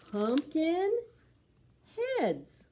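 rising then dropping title; dramatic title. Acted. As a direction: announce the title with a flourish.

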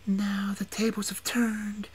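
slow, whispered witch threat; menacing whisper. A narrator's reading.

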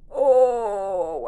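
loud burst of fan joy; excited cry. Real, not acted. Fan spotting Esquire shoot, loud thrilled oh. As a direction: let out a thrilled yelp.

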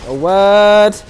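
loud, high "what?!"; jolted by a surprise in the game; loud high disbelief; startled outburst. Real, not acted. Shocked at game event.

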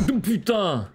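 high, loud jump-scare yelp; startled cry. Real, not acted. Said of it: "je me suis chié dessus".

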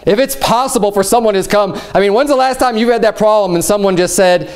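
ordinary delivery

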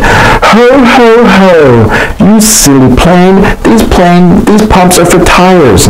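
high, slow mock jolly laugh-talk; silly Mister Pumple. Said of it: "Mister Pumple said".

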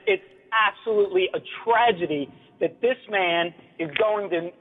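ordinary delivery

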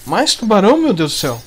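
loud, dismayed cry; exasperated shout. Real, not acted. Another shark appears.

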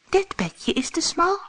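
put-on high-pitched little storybook girl; small, high character voice. A narrator's reading.

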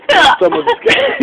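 high, squealed through real laughter; helpless laughter. Real, not acted. Friend with aching stomach, laughing helplessly. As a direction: barely get it out for laughing. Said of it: "killing me from laughing so much".

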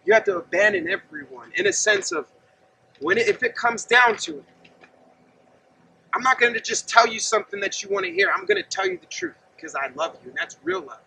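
ordinary talk

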